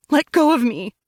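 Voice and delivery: high, loud cry to be released; shouting. Acted. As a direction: yell it, pulling away. Wife struggling free.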